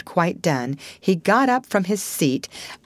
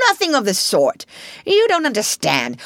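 raised, indignant Mole; argumentative; 6 semitones higher, 4 dB louder than usual. Acted. Mole overrules Rat in a squabble, indignantly. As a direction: contradict him hotly.

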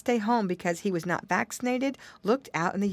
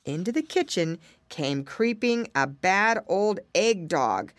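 ordinary delivery

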